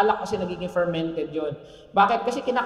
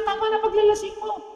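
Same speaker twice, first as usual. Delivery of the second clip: stern priest; 12 semitones higher than usual. Retold or imitated. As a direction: rebuke her like a stern priest. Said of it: "Sinabihan siya". (paring sumisita nang mataas ang boses)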